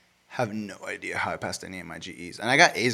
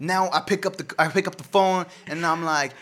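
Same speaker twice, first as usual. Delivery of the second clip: loud, high mock tech-support testimonial; hammed-up ex-gangster spokesman voice; former gangbanger; 6 semitones higher, 7 dB louder than usual. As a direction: parody a reformed gangbanger pitching his new job. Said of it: "former gangbang".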